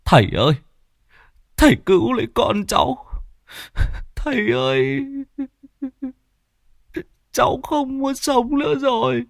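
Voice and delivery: very high, tearful begging; wailing plea. Acted. Distraught father begs the shaman for his son, high tearful wail. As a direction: wail it out as if breaking down.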